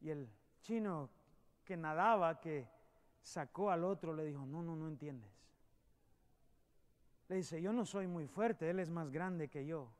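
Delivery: soft, low storytelling voice; a preacher's hushed parable; hushed sermon story. A narrator's reading. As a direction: draw them in quietly.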